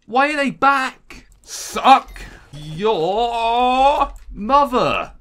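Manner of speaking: slow, loud, high howl; drawn-out yell. Real, not acted. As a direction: stretch it out in a howl. Enemies return, drawn-out yell.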